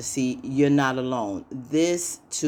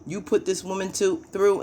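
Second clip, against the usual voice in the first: higher-pitched retold outrage; mock outrage; 6 semitones higher than usual. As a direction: act out her losing it, lightly. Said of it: "like, lost it".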